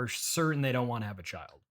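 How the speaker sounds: ordinary talk, nothing marked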